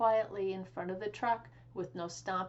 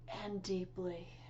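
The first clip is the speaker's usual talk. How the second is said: whispered, slow meditative hush; breathy whisper; 6 dB quieter than usual. A narrator's reading. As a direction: narrator hushing for the meditating duck. Duck breathing deeply.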